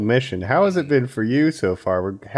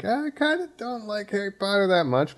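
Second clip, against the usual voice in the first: high, soft, hedging self-quote; lightly whiny imitation of his doubtful self; mock reluctance; 9 semitones higher, 4 dB quieter than usual. Host recalling his first impression of the series, mock reluctant.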